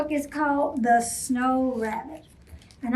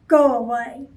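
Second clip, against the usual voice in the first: gruff, louder brush-off; grumpy; 6 dB louder than usual. Acted. Grumpy Bear sending cheerful Rabbit away, gruff. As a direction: push her away with a grump.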